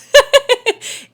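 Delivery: loud high burst of laughter; bright laugh. Real, not acted. Speaker laughing at her own joke, loud laugh.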